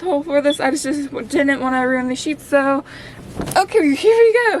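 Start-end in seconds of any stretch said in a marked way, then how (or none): 3.6-4.6 excited reveal. Pretend. Ghost costume reveal.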